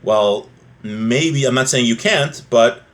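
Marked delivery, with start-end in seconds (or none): none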